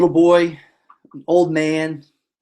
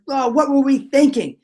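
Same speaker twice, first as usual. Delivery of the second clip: mock despair; 6 semitones higher than usual. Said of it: "Like tearing at their hair and their clothes".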